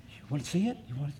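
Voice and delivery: soft coaxing question; coaxing a pet. Shown as a demonstration. Preacher coaxing the pet to come, soft. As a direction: draw it in gently. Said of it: "I'll go like this".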